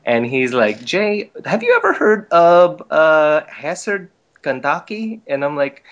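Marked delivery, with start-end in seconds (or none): 0.9-3.4 imitated Filipino accent, higher and louder. Cousin asking Jay about his first job's town, Filipino accent. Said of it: "the accent of Datu". 3.5-5.2 slow, higher-pitched imitated Filipino accent. Cousin naming his first job's town, Filipino accent. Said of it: "the accent of Datu".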